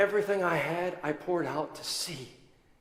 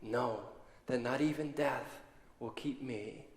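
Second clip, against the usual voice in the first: much softer, lower loving voice; tender; 5 semitones lower, 12 dB quieter than usual. Acted. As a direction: speak gently as Jesus to Mary. Jesus speaks gently to Mary.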